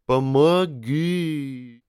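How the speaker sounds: very slow, high, warped plea; eldritch horror. Acted. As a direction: drag out a monstrous cry for help. Mutating colleague begs for help.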